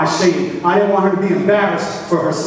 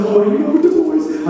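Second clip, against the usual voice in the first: much higher, giddy; boy biking over, giddy and high; excited; 10 semitones higher than usual. Retold or imitated. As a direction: bounce it with boyish glee. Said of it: "I'm so excited".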